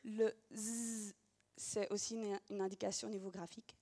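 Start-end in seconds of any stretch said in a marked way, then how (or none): 0.5-1.1 "z" as a long voiced buzz; isolated phoneme. Shown as a demonstration. Teacher setting it beside s, "z" held buzzing. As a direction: sustain "z" as a sound, not the letter name. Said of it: "bien différencier".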